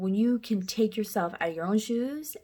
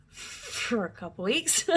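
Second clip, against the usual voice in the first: laughing; 9 semitones higher, slower than usual. Real, not acted. Laughing about treatment.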